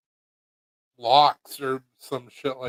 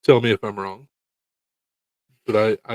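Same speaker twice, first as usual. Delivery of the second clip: ordinary delivery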